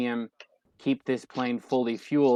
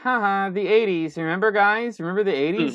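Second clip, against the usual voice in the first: high, louder cheesy voice; mock nostalgic heroine; 7 semitones higher, 4 dB louder than usual. Critic parodying the film's eighties jokes, high and hammy. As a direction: sell the eighties gag like a bad script. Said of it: "And it's like".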